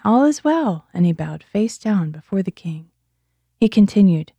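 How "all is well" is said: higher-pitched call without much added volume. A narrator's reading. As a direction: read it as a measured call to the king. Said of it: "called out to the king".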